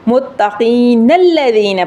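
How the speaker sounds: slow "متقین الذین" linked as muttaqeena-lladheena; joined without pause. Shown as a demonstration. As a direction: run "متقین" straight into "الذین" with long ee vowels. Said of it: "پڑھنا ہے".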